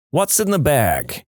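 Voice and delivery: put-on slow, heavy Jersey accent on a cop's question; lightly imitated New Jersey cop, slow and thick; a retold Jersey cop, slowed and thick-voiced. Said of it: "in a really heavy Jersey accent".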